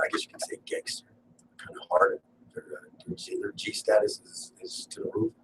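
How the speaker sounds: hushed gossip to listeners about tough friends; conspiratorial hush. Real, not acted. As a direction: drop to a secretive half whisper. Hushed aside about gangster friends.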